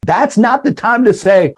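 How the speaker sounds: normal talking voice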